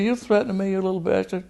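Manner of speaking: high-pitched, incensed comeback; retold angry challenge. Congressman answering Roy's threat, mad. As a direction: re-enact being furious at a threat. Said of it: "I was so mad by now".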